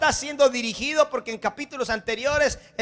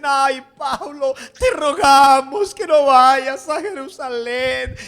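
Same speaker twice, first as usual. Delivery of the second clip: drawn-out, louder tearful plea; melting in emotion, begging Paul not to go; pleading disciples; 6 dB louder, slower than usual. Acted. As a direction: beg him slowly, close to tears. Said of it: "se derriten en sus emociones".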